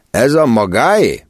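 lightly voiced official asking warily; questioning with mild suspicion; wary customs officer. A narrator's reading. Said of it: "kérdezte a biztonság kedvéért".

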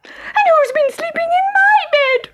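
put-on very high squeaky baby bear squeal; tiny bear cub squeaking in dismay. A narrator's reading. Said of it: "squealed Baby Bear".

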